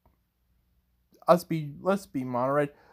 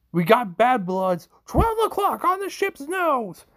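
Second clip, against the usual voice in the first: high, loud warning shout; shouted combat callout, climbing much higher; yelling; 10 semitones higher, 5 dB louder than usual. Acted. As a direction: yell an urgent alert to the pilot. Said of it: "he just starts yelling".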